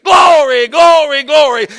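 loud fading shouts of glory; screamed glory. Retold or imitated. Preacher retelling roadside worship, screaming. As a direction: scream it to the heavens, then fade. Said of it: "I screamed to the heart, as loud as I could".

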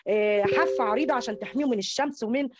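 ordinary talk, nothing marked

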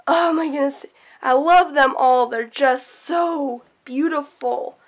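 normal talking voice

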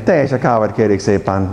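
low, descending Hungarian phrase; Hungarian falling intonation. Shown as a demonstration. Teacher shows Hungarian coffee request, falling Hungarian intonation. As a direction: slide down to very low, Hungarian-style. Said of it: "They start very high and finish very low".

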